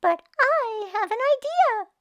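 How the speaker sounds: high squeaky little bat; high, tiny bat voice; small bat voice. Acted. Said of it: "said the bat".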